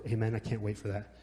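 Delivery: ordinary talk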